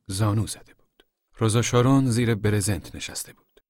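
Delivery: plain reading voice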